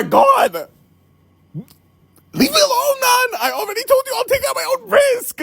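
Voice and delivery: high, squealing mock scream; exasperated outburst. Customer at the end of their patience on the phone, exasperated. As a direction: wail it high in hammed-up frustration.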